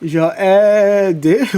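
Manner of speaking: Jawadi stretched very slowly with a rising end; slow, questioning Jawadi; drawn-out name. Shown as a demonstration. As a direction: drag out Jawadi, trying it on. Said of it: "rereads name from chat".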